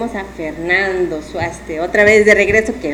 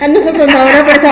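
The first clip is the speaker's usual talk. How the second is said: laughing; 6 semitones higher, 7 dB louder, faster than usual. Real, not acted. Laughing playful echo.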